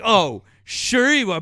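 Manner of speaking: high, loud, drawn-out sarcasm; mocking disbelief. Retells opponent's sarcastic disbelief.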